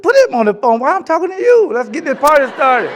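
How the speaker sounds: high, loud, excited demand; can't wait to get on with it; eager young player. Retold or imitated. Young draftee urging the scout on the phone, high and excited. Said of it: "I said".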